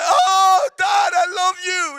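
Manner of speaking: very high, loud, slow cry to dad; tearful shout. Retold or imitated. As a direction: shout it through happy tears. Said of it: "I'm starting to bawl my eyes out".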